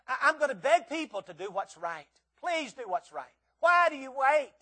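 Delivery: loud, high pleading from the pulpit; fiery revival preacher begging the congregation; impassioned preaching. Acted. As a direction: plead with them as if lives depend on it. Preaching at full force.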